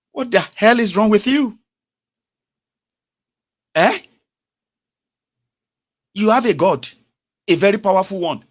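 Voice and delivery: high, loud, outraged rebuke; real indignation. Real, not acted. Rebuking those who fight for God, indignant. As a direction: raise pitch and volume in exasperated challenge.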